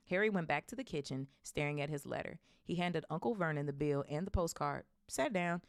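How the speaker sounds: ordinary delivery, nothing marked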